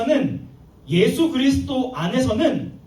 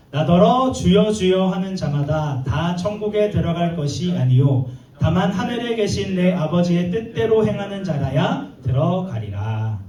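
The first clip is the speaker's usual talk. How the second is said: measured, lower-pitched verse reading; scripture reading; 4 semitones lower, 4 dB louder than usual. Acted. Preacher leads congregation in reading the verse aloud, solemn recitation. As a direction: read the verse out firmly to the congregation.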